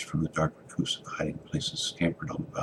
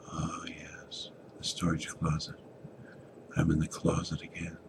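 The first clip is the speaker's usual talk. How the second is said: whispered realisation; 8 dB quieter than usual. Acted. Boy whispers in dark closet.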